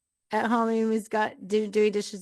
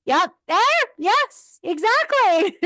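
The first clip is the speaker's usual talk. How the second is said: high, emphatic yes-yes-exactly; excited agreement, higher and louder; eager agreement; 11 semitones higher, 5 dB louder, slower than usual. Retold or imitated. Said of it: "I'm like".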